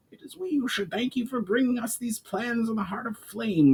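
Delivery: high goblin voice; a goblin chief giving thanks; Brawl, grateful goblin. A narrator's reading. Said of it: "responded Brawl".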